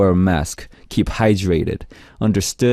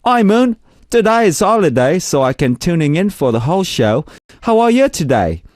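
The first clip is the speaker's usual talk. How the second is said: put-on Aussie accent; 8 semitones higher than usual. Radio host reads a listener's message from Australia, Aussie accent. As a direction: put on an exaggerated, high-pitched Aussie accent. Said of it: "I'm gonna read this in Aussie accent".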